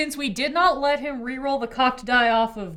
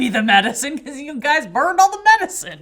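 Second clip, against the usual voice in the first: loud, higher-pitched exasperated outburst; loud I-told-you-so; 5 semitones higher, 10 dB louder than usual. Real, not acted. Player scolding the group over burned medicine, exasperated. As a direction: blame them all at full volume. Mock outrage at fellow players.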